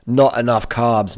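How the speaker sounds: normal talking voice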